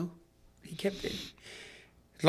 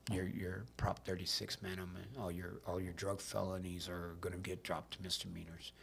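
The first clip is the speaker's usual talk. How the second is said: hushed quote; 5 semitones lower, 16 dB quieter than usual. Retold or imitated. Judge quietly grants relief.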